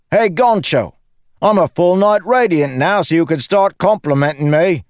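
high, loudly triumphant joker; gleeful cocky bragging; cheeky Lopen, boasting. Acted. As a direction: crow about it, pitched right up. Lopen brags to Kaladin.

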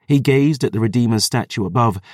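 plain reading voice

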